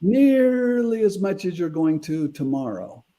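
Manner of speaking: "nearly" drawn out long and high; high, slow stress on "nearly"; emphatic retold declaration. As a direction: stretch NEARLY way out, then let the pitch slide down. Continues daughter's emphatic declaration.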